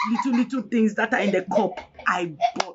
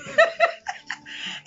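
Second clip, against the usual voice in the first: laugh. Real, not acted. Laughs while telling her story.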